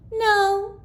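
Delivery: put-on high child's no; a little kid denying it. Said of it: "said Joey".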